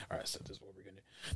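quick, voiceless secret plotting; hushed and hurried; conspiratorial whisper. Retold or imitated. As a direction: whisper the plan on the sly. Voicing characters secretly plotting.

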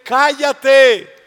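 very loud, high angry yell; furious shout. Acted. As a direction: bark it at him. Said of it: "qué le decía a la gente".

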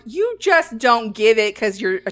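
loud, emphatic put-down; retold insult thrown out loudly; mock insulting. Diner putting down a critic over the concept, mock insulting. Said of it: "he's insulting you right now".

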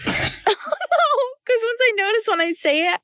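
high-pitched, giggling explanation; laughing. Real, not acted. Explaining to a friend why saying like sets her off, laughing. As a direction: try to explain through giggles at yourself. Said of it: "I laugh at it a lot".

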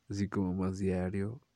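ordinary talk

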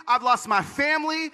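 high, aggrieved protest; anguished accusation, re-enacted; angry at God. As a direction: throw it up at God, pitched high. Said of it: "I was so angry at God".

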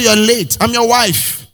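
high mock scolding wife; a preacher voicing a wife scolding her husband; nagging wife. Pastor playing a wife, mock scolding.

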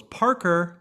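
retold parent's call; calling out a name. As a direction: call out like a mom summoning a kid. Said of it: "the mom goes".

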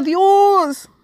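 high, drawn-out call to God; acting out a loud cry to heaven; imitated cry out. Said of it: "gritó y le dijo".

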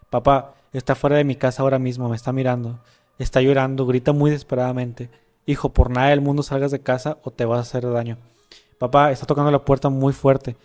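the son texting his dad from a disco, read out loudly; dramatic story reading. Acted. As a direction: read the chat story aloud with energy. Reading chat story aloud.

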